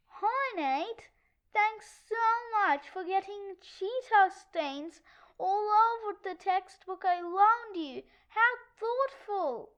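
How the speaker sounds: sugary sarcasm with pointed stresses; mock-grateful, syrupy and cutting; fake sweet. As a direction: sound sweet while not meaning it at all. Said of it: "sound like she's being sweet, but she's really not".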